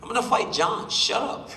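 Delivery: high-pitched mock taunt; the fighter retells brushing off Arthur with a high-pitched mock taunt; mocking retort. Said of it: "I'm like".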